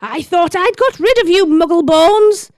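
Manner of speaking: high, menacing put-on voice; villainess character voice. Bellatrix demands Potter from the riders, high villainous taunt. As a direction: taunt them high, stretching the insult.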